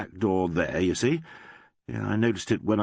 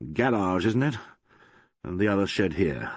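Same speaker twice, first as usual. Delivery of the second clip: plain reading voice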